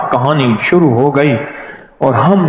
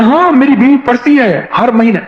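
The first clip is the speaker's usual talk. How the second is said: excited shopkeeper; 8 semitones higher than usual. Retold or imitated. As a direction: voice raised high with eager delight, a retold shopkeeper. (دکاندار کی پرجوش، اونچی آواز)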